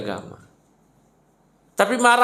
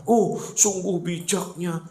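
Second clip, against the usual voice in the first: drawn-out sarcastic praise, sinking low; mock admiration; 5 semitones lower, 4 dB quieter, slower than usual. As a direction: feign awe at his wisdom. Mocking the forgiving husband as wise.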